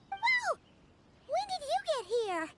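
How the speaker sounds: sudden high startled whoa; startled gasp. Acted. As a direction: jump at the surprise.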